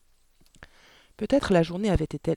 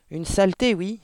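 louder, higher cry of disgust; indignant exclamation; 5 semitones higher, 6 dB louder than usual. A narrator's reading. Father dismissing his daughter's book, indignant cry. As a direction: cry out in scorn. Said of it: "s'écria monsieur Verdebois".

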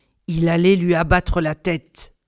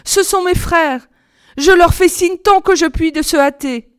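high, urgent relieved call; raised, eager lookout voice; excited exclaiming; 8 semitones higher, 5 dB louder than usual. A narrator's reading. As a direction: announce rescue excitedly. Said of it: "s'écria-t-elle".

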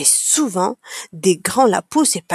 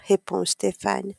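usual speaking voice

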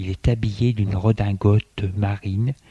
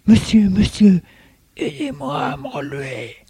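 put-on breathless old person; 10 semitones higher than usual. A narrator's reading. Old man fallen, calling for help.

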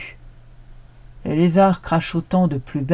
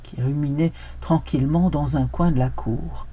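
plain reading voice

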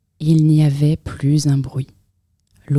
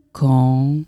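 ordinary delivery, nothing marked

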